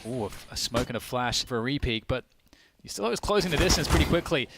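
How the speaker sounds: ordinary delivery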